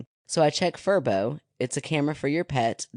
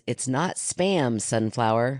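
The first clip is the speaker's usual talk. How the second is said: slow title read; slower than usual. Real, not acted. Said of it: "the title is".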